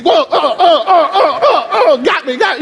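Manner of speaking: put-on high, laughing yelps of a kid being hit; mock kid getting whooped. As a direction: ham up a child flinching from mama's slap in the back seat.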